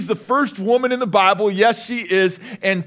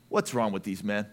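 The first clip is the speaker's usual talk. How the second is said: ordinary talk, nothing marked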